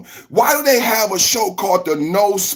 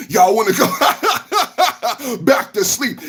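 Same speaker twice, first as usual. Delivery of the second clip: mocking laugh; 3 semitones higher, much slower than usual. Real, not acted. Laughing mockery at chat.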